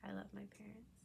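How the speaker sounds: soft unvoiced whisper; tender whisper to the camera. Real, not acted.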